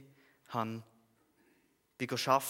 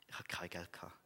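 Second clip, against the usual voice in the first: whispered confession; 6 semitones lower, 11 dB quieter than usual. Real, not acted. Preacher confessing to the church he was broke, whispered. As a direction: drop to a whisper. Testimony, low point, no money.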